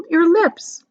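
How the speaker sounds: ordinary delivery, nothing marked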